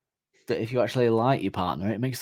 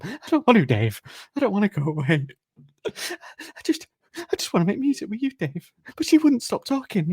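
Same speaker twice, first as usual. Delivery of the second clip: very high, hammed-up whimpering plea; childlike pleading; 11 semitones higher than usual. Prisoner begging his bandmate to stay, distraught high voice. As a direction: beg in a squeaky, distraught voice.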